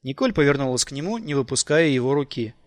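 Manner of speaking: plain reading voice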